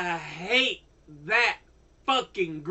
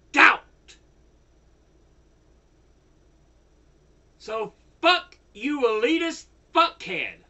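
loud, higher, punched words; angry shouting; 5 semitones higher, 6 dB louder than usual. Real, not acted. Furious ranter yelling at the elitists. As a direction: hammer every word in rage. Ranting against lobby group.

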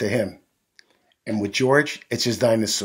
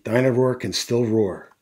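ordinary delivery

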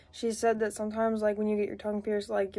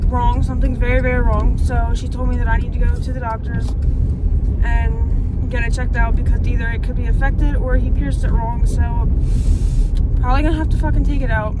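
loud frustrated talk; 2 semitones higher, 13 dB louder than usual. Real, not acted. Car ride, irritated, loud.

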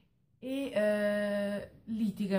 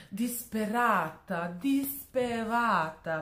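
DISPERATA drawn out slowly, syllable by syllable; hammed-up emphasis on "disperata", very slow; emphatic drawn-out repetition; 4 semitones higher, 4 dB louder, slower than usual. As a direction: stretch each disperata, higher the second time. Hammed-up emphasis on desperate heroine.

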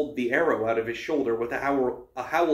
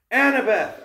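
loud, high-pitched desperate call; yelled; 10 semitones higher, 8 dB louder than usual. A narrator's reading. Boy sees his friend go over the cliff, yelling. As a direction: yell after a falling friend. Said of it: "I yelled".

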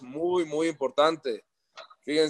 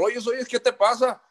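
higher, urgent call to wake him; imitating his mentor; 8 semitones higher than usual. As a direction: mentor shaking him out of shadowboxing. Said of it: "me dice mi maestro".

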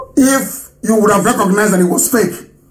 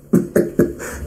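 short unvoiced scoff of a laugh; quick breathy mocking chuckle; 6 dB quieter than usual. Real, not acted. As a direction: laugh it off in scorn. Mocking laugh mid rant.

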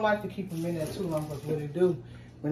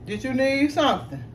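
real raised-voice question; calling out; 6 semitones higher than usual. Real, not acted. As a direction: call over to check what they need. Calls out to someone nearby.

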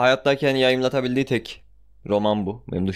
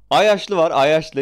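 loud; 6 semitones higher, 6 dB louder, much slower than usual. Real, not acted. Announcing the title character.

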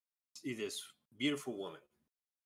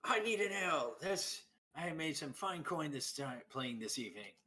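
loud, high-pitched call that settles as it goes; entertainer's boisterous entrance; 8 semitones higher, 5 dB louder than usual. Acted. Entertainer kicking the tavern door open, boisterous call for ale. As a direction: burst in calling out your order, pitched up. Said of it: "He goes".

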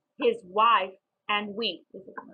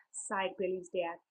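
ordinary delivery, nothing marked

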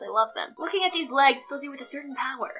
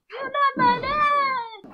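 silly slow voice; 11 semitones higher, much slower than usual. Pretend. Repeats line in a weird voice.

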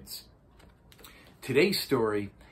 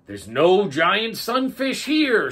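loud, slow shout; 10 semitones higher, 10 dB louder, slower than usual. A narrator's reading. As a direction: call it out loud. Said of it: "shouted the octopus".

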